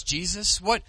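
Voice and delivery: normal talking voice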